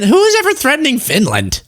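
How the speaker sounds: ordinary delivery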